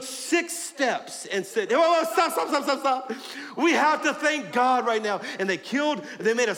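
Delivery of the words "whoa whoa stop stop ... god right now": high, loud cry of stop; urgent halt. Acted. David's men stop the march to thank God, crying out. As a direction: call the procession to a sudden halt.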